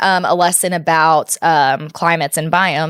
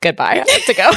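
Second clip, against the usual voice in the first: laughing. Retold or imitated. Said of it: "I was like".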